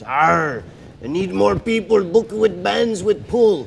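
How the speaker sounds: loud, high pirate growl-out; Blackbeard. Acted. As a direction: play a pirate bellowing his demand. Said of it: "Blackbeard gets".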